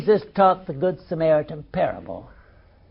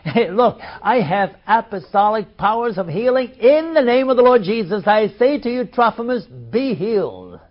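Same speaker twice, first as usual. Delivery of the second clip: mock faith healer; 3 semitones higher, 4 dB louder than usual. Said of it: "why didn't Paul say".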